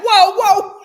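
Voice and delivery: high, loud mock outcry; hammed-up gasp of shock. Shocked reaction, mock cry.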